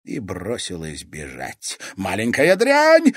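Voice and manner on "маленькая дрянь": high, loud insult; furious raised cry; yelled after her. A narrator's reading. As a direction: hurl the abuse after someone fleeing. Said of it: "неслось ей вдогонку".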